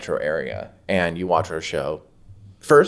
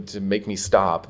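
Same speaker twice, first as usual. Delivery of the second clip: normal talking voice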